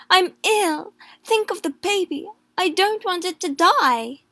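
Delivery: put-on a sick mother fearing for her baby; wife begging her husband to stop; desperate plea. A narrator's reading. Ill pregnant wife pleading.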